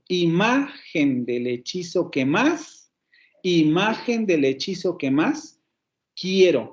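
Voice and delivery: "Imagen del hechizo que más" slowly, leaning on MA and MÁS; marked verse accents. Shown as a demonstration. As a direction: stretch "más" upward, then drop on "quiero". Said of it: "vuelve a caer el acento".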